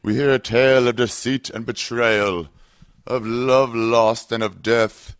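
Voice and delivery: a hammy spooky narrator spinning a tale of doom; Vincent Price impression. Host opens Halloween episode with a spooky horror-host voice. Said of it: "That was my impression of Vincent Price".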